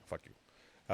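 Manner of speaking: whispered mock insult; whispered jab. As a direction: breathe it out teasingly. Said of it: "No, I'm not kidding".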